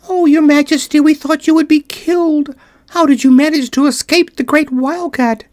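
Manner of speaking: high, squeaky voices full of worry; tiny mice fussing over their queen; anxious little mice. Acted.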